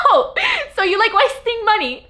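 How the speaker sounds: high, laughing mock voice of her teasing friend. Friend Brianna mocking her spending, high and laughing. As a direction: tease her about wasting money.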